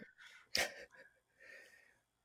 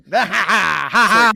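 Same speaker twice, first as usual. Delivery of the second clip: gloating laugh; 7 dB louder than usual. Acted. Host gloats after stumping them.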